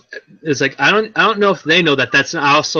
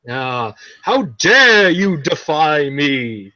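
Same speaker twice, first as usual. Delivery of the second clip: slow, hammy villain queen voice; evil queen; much slower than usual. Retold or imitated. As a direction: drag out a haughty evil queen threat. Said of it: "she pretends to be Queen Bee and goes very outlandish".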